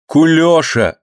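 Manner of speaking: high, urgent call for help; desperate call. A narrator's reading. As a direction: call out to him in despair. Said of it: "в отчаянии позвала мать". (отчаянный зов повыше)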